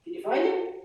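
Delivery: ordinary delivery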